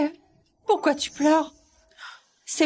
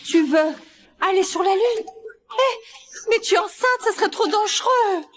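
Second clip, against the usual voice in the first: excited; 8 semitones higher than usual. Acted. Grandma fussing over pregnant Sabine.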